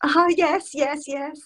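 put-on high, gushing Scottish accent; hammed-up Scots, stretching the last words. Fan confirming to Kiefer she is Scottish, gushing in Scottish accent. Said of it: "you can do that accent".

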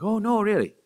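slipping into a relative's Irish lilt. Retold or imitated. Chatting with an Irish relative, mock Irish lilt. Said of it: "pick up the cadence".

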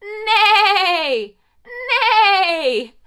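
loud, high neighing horse; whinny like a horse, loud and high; big playful horse neigh. Shown as a demonstration. Horse sound on count of three.

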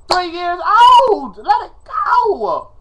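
high-pitched, drawn-out dramatic wail; hammed-up agitated plea, way up high; agitated outburst. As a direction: stretch it out in exasperated protest. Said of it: "I'm getting agitated right about now".

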